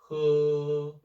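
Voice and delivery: he held longer and level; long first tone. Shown as a demonstration. Teacher contrasting the long first tone with the neutral tone. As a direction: draw the he out, steady. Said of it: "This one is like".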